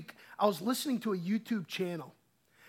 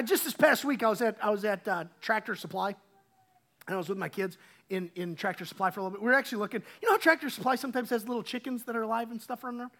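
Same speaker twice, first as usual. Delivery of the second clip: ordinary talk, nothing marked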